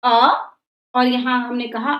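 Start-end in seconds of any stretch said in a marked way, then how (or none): 0.0-0.3 short a. Shown as a demonstration. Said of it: "दुगना समय लगा".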